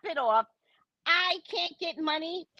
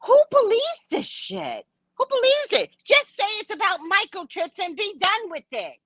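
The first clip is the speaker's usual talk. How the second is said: high, exasperated outburst; worked-up, incredulous rant; very emotional; 6 semitones higher than usual. Real, not acted. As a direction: burst out high in disbelief. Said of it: "I get very emotional".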